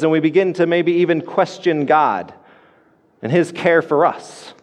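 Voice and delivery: ordinary talk, nothing marked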